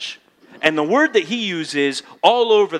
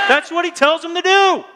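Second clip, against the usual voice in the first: much higher and louder, held at the top; peak of the build; 14 semitones higher, 5 dB louder than usual. Shown as a demonstration. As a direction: land the climax at full pitch. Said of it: "You notice my voice is going up".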